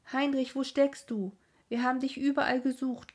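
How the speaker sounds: high, loud calling, a bit hurried. A narrator's reading. Mother calling her son home to eat, loud and high. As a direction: call him in, worried and quick.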